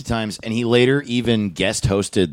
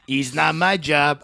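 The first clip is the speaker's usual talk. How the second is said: slow catchphrase in a put-on accent; Chico's accent; 5 semitones higher, slower than usual. Podcaster repeating Chico's catchphrase, mock accent. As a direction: stretch it out in a mock sitcom accent. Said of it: "I'm sorry I'm doing that accent".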